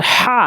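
"হা" long, squeezed from mid-throat; pressed ha. Shown as a demonstration. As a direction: push breath hard through "হা". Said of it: "চাপ দিয়ে".

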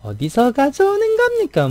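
very high, stiff Busan-tinged Seoul imitation; a Busan native straining to sound Seoul; Busan person faking Seoul speech. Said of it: "뭐 이렇게 하던데". (서울말 흉내 내는 높은 톤의 부산 억양)

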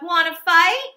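loud, high dare; grouchy ladybug. Acted. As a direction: taunt loudly. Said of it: "said the grouchy ladybug".

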